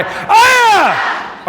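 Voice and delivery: high, drawn-out battle cry; pretend yelling. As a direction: yell it high as if at the kids. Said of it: "Pretend you're yelling at your own kids".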